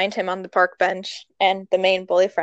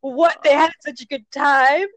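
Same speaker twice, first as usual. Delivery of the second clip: hammed-up tearful lament, raised pitch; mock bawling; 6 semitones higher than usual. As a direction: act out bawling over a sad movie.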